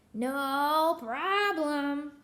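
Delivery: slow, stretched no problem; drawn-out witch voice. Acted. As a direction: draw it out smugly like a hag. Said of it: "said Gritch".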